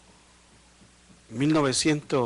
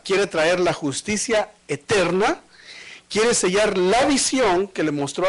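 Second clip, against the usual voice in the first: slow emphatic list; 3 semitones higher, slower than usual. Acted. Solemn list of God's aims.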